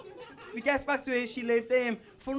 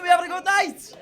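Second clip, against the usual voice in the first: crowd call; 6 semitones higher, 9 dB louder than usual. Real, not acted.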